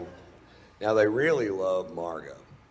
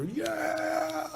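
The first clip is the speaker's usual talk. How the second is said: crowd cheer. Retold or imitated. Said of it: "They go".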